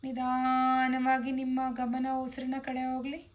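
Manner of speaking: long drawn-out vowels, sustained even tone; yoga instructor's measured, resonant chant-like guidance; guided relaxation. Acted. Leads a guided body relaxation.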